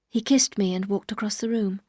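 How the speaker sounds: ordinary delivery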